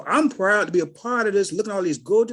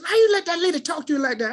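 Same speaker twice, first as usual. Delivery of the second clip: higher, fast, indignant question; indignant friends; 8 semitones higher, faster than usual. Retold or imitated. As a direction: friends demanding an answer, worked up.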